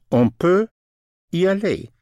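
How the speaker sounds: On peut y aller said high and loud, each sound clear; careful French model. Shown as a demonstration. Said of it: "Peut spelled P U T".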